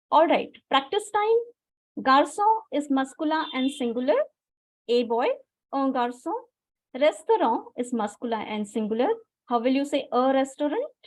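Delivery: usual speaking voice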